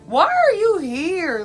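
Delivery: high squealing disbelief, slowing down; shocked squeal. Retold or imitated. Narrator seeing her date walk into Roscoe's, high shocked squeal. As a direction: shriek in shock at the ex-date showing up. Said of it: "I'm like".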